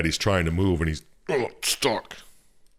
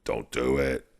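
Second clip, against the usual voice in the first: lower, softer pleading demon; low demon warning; 7 semitones lower, 5 dB quieter than usual. Retold or imitated. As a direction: coax him away quietly and low. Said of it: "the darkness is like".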